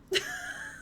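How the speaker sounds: very high soft giggle. Real, not acted. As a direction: let out a tiny high giggle.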